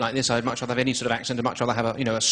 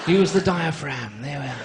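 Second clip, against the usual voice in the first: slow, drawn-out voice-coach instruction; slow drama coach; 2 semitones higher, slower than usual. Retold or imitated. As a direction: coach the breath slowly. Posh man coaching mugger.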